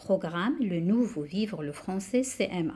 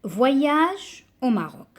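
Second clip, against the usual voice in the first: slow, higher-pitched recited title; poem reading; 4 semitones higher, 4 dB louder, slower than usual. Shown as a demonstration. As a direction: announce the poem's title, drawn out. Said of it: "on va commencer notre lecture". (lecture de poème, titre déclamé lentement)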